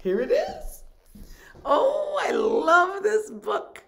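high, delighted squeal; excited squeal. Real, not acted. Finds the favourite book.

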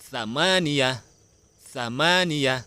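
ซามานียะ with long, loud มา; model pronunciation. Shown as a demonstration. Teacher shows children Arabic eight, ซามานียะ pronounced high and clearly. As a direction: say "ซามานียะ" high, leaning on มา, then falling. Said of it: "ออกเสียงว่า".

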